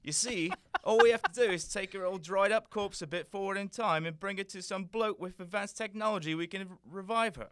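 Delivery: very loud, fast cockney; cockney Doctor. Acted. The Doctor offering to help the prince, in loud cockney. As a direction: boast in brash cockney.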